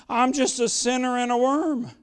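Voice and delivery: ordinary delivery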